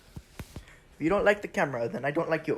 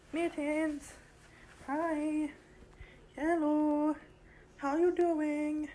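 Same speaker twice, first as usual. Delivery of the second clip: high, sing-song voice for the cat; cat-calling voice; 9 semitones higher, much slower than usual. Real, not acted. Owner coaxing her cat Mittens, high sing-song. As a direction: coax the cat over, pitched way up.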